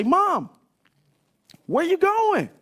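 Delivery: put-on high, loud little-kid voice; a small boy calling after his mother; child calling mom. Little boy calling after his leaving mom, high and pleading.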